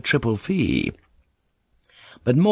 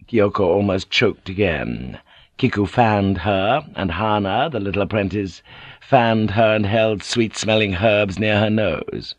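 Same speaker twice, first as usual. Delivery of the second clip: ordinary talk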